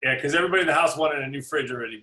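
ordinary talk, nothing marked

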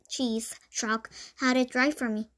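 ordinary delivery, nothing marked

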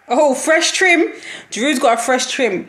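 high, drawn-out delight; excited. Real, not acted. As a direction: gush over the new haircut. Excited spotting Giroud's haircut.

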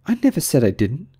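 high, protesting Alice. A narrator's reading. Alice interrupting the Mock Turtle, high and protesting.

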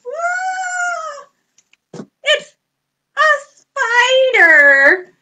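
high, loud pretend scared cry; hammed-up scare at a spider; mock fright. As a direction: act spooked to thrill the kids.

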